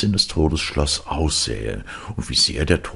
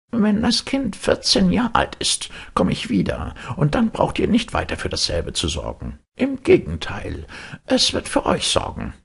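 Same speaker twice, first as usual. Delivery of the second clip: Death's voice. Acted. Death speaks to the parents.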